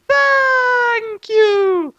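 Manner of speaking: very high, drawn-out hello; falsetto doorman. Acted. Doorman greeting Light's mom. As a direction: croon it.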